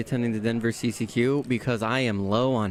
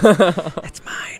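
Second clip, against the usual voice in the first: whispering; 5 semitones higher, 8 dB louder than usual. Acted. Joking guarding his card.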